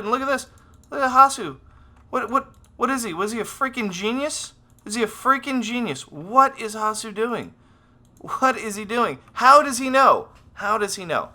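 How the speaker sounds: high-pitched, excited disbelief; amazed commentary. Real, not acted. Amazed at player's uncanny read.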